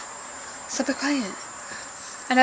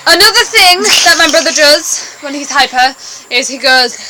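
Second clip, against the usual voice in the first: ordinary talk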